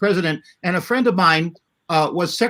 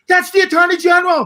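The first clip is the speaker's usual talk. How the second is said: high, loud cry of recognition from a woman; excited lady in the audience; 11 semitones higher, 5 dB louder than usual. Retold or imitated. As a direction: call it out like a thrilled spectator who just recognised him. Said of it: "a lady in the audience says".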